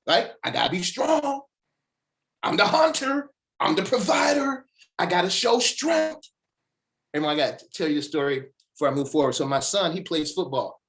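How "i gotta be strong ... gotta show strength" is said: high, loud mock tough-guy voice; mock macho man. Speaker mocking men who hide weakness, loud mock macho. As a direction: puff up like a proud hunter. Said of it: "But men, historically".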